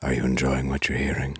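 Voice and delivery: low, soft seductive voice; sultry narrator. Pretend. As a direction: purr it low into the mic. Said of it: "sultry voices in your ear like this".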